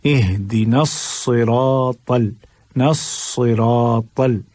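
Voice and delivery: slow सिरातल with heavy s, dark रा and thick त; thick ra and sad. Shown as a demonstration. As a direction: draw out a full-mouthed सिरातल. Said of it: "रा को मोटा करना है". (सिरातल में रा और स्वाद मोटा)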